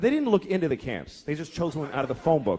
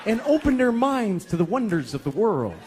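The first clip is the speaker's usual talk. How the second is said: judgmental onlooker. Acted. Childless onlooker judging a mother, sanctimonious. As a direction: build into a lofty, preachy vow. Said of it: "And you think".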